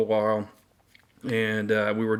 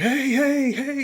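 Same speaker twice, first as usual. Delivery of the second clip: high mock yell of hey, hey; retold yelling; slower than usual. Soldier behind him calling out, a high imitated yell. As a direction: shout to stop the march, imitated. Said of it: "yelling and screaming".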